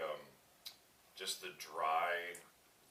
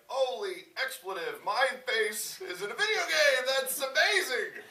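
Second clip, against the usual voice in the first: loud, very high, stretched-out squeal; hammed-up excitement; 12 dB louder, slower than usual. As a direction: shout it with overjoyed, drawn-out amazement. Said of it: "your first reaction is like".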